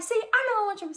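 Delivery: high, loud mock mom voice; scolding mom. Mother catches daughter with hickey, high scolding mom voice.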